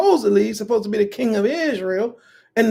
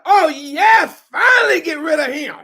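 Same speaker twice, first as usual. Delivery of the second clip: excited; 5 semitones higher, 4 dB louder than usual. Retold or imitated. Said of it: "She was so excited about that".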